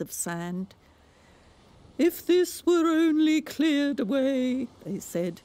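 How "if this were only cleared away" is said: high, louder storybook walrus. A narrator's reading. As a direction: proclaim it like a pompous walrus. Walrus and carpenter speak.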